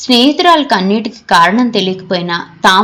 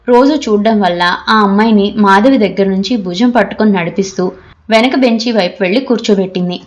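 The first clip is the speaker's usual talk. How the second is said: ordinary delivery, nothing marked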